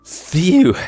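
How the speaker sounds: loud high sigh of relief; relieved phew. A narrator's reading. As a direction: let all the fear out in one breath. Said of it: "breathed a deep sigh of relief".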